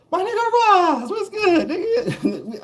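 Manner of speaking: very high, loud greeting through a laugh. Retold or imitated. The big homie greeting Roz at the bus stop, high and laughing. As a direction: hail him like an old friend, cracking up.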